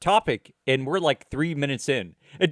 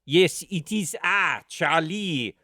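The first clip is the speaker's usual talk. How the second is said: put-on stagy French accent, higher and drawn out; fake French accent; 4 semitones higher, slower than usual. Actor quoting dialogue from a show set in France, fake French accent. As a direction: ham up a Frenchman's accent, stretching the name. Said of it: "had to use a French accent".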